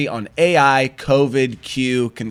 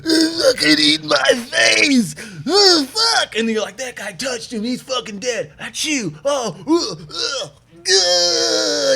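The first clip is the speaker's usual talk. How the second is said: mock catastrophizing; 10 semitones higher, slower than usual. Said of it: "different than the movies where".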